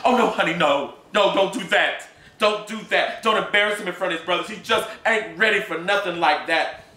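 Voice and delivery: high, loud, desperate pleading; begging woman. Acted. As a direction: beg him to stop. Wife begs him to stop.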